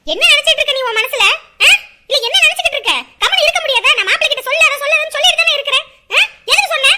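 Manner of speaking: loud furious yelling; angry yelling. Acted. Mother-in-law yelling at her daughter, loud and angry.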